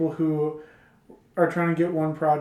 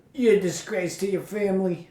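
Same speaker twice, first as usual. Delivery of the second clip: mock Missus Lobo scolding; 3 semitones higher than usual. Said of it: "this is my impersonation of her".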